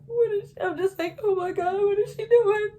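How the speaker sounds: high, strained, half-crying disbelief; shocked disbelief. Retold or imitated. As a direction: squeal it out in stunned horror.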